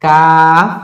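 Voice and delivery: কাফ loud, with a long held vowel; drawn-out letter name. Shown as a demonstration. Teacher naming a letter for learners, drawn out. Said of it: "টেনে বলতে হবে".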